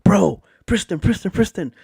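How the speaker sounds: grab your mate's attention, alarmed; imitating a shocked friend; urgent friend's whisper-shout. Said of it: "And he goes".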